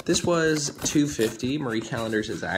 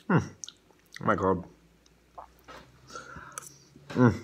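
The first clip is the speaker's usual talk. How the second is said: talking while chewing food; muffled through a mouthful; mouth full, chewing; 2 semitones lower than usual. Real, not acted. Seen 0: Bite taken just before; dim lower face, mouth closed and working.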